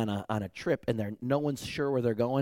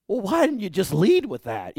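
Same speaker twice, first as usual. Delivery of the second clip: higher, louder exasperation; exasperated; 6 semitones higher, 5 dB louder than usual. Retold or imitated. Hikers exasperated at their guide, higher and louder. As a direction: throw up your hands. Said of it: "we all kinda looked at him like".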